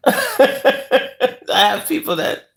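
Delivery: slow words through a high giggle; laughing. Real, not acted. Answering a first-time viewer, laughing through it. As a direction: laugh while starting to answer.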